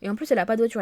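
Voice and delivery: ordinary talk, nothing marked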